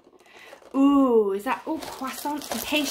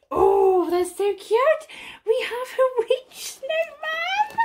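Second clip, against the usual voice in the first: excited squeal; 12 semitones higher, 5 dB louder than usual. Real, not acted. Unboxing a cute calendar piece.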